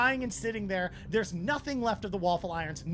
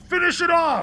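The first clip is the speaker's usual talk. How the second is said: loud, high kill command; shouted order; 8 semitones higher, 11 dB louder than usual. Acted. Game master voices attackers ending the raid, shouted order. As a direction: holler it over the gunfire.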